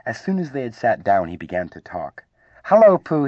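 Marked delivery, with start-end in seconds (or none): 2.6-3.2 very high, loud little greeting; excited squeak. A narrator's reading. Excited youngster greets bear at his first party, squeaking. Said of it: "he squeaked".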